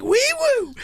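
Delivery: siren-like whoop. Pretend. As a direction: whoop with joy. Celebratory whoop.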